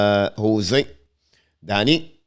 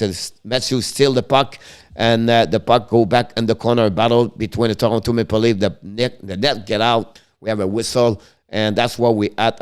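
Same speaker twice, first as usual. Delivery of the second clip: running hockey commentary, steady and brisk; live play-by-play; 5 dB louder than usual. Acted. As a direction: call the game as it happens.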